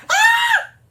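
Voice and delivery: very high, very loud shriek; piercing squeal at full volume; excited scream. Real, not acted. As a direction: scream with fangirl delight. Thrilled reaction to the film.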